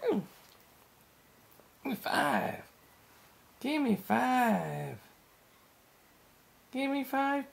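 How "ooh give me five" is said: usual speaking voice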